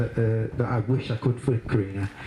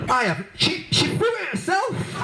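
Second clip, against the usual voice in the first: indignant protest. Retold or imitated. Brother protesting to Mum that sister threw it herself, high laughing denial. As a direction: protest your innocence, squeaking higher.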